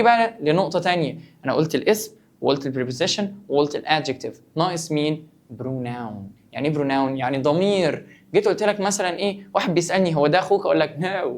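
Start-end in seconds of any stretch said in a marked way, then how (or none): none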